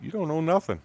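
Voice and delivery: high-pitched mock-puzzled clerk; baffled official. The guy on the computer, mock bafflement. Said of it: "The look on his face like".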